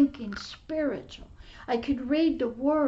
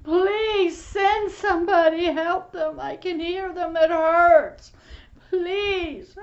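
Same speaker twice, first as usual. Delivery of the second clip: louder, higher anguished plea to God; tearful pleading prayer; 3 semitones higher, 6 dB louder, slower than usual. Retold or imitated. Preacher reliving his weeping prayer for the lost. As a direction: cry out to God for them. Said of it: "And I would cry".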